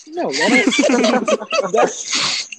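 words break into high, loud laughter; laughing. Real, not acted. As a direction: crack up while protesting, dragging the words out. Breaks up laughing at own story.

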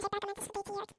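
ordinary delivery

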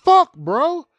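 high, loud shocked cry; outraged disbelief. Retold or imitated. At the party seeing the matching outfits, loud shocked yell. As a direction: yelp it in disbelief. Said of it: "I'm like".

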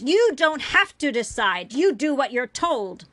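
heated, forceful rant; yelling. A narrator's reading. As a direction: hurl accusing questions at full voice. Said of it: "she yelled".

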